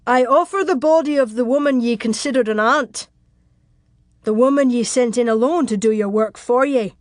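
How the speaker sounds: loud declaration in character; defiant shouted challenge. Acted. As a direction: throw the words down at the enemy. Castle lord shouts down to his enemy.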